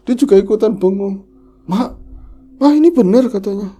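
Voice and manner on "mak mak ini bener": high, loud stunned call; stunned husband. Retold or imitated. Husband calling the mother after reading the message, loud high shock. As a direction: cry out in disbelief.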